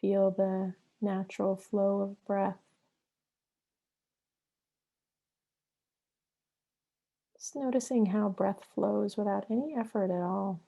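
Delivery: unhurried meditation guide with long pauses between phrases; guided meditation. Acted. Yoga therapist guiding listeners into breath and flow, guided meditation. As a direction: lead them into the breath, leaving long silences.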